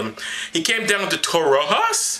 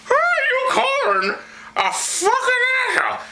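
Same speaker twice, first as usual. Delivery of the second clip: high, indignant whiny apostle; high Paul voice. Acted. Paul snaps back at Barnabas, high and offended.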